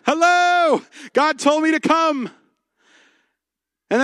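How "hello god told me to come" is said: higher, brighter mock announcement of himself; naive eager newcomer. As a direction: ham up a starry-eyed new pastor arriving. Pastor mocks his naive younger self.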